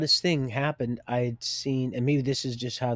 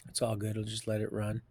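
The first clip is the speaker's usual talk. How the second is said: normal talking voice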